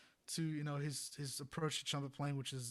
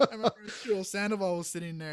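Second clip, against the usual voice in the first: usual speaking voice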